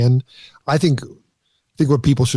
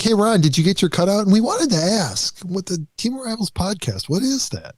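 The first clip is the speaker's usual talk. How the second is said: higher-pitched mock phone caller; put-on high voice, teasing; imagined team caller; 8 semitones higher than usual. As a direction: play a curious caller from the team. Co-host acts out the team's caller.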